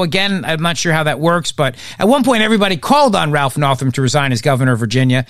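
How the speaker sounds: ordinary delivery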